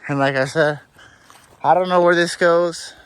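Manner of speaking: winded from the steep uphill; panting a little between words; out of breath. Real, not acted. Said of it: "Sorry, I sound like I'm out of breath".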